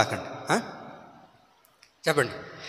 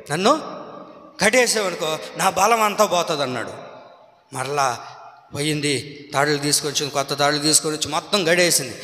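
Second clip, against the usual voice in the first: normal talking voice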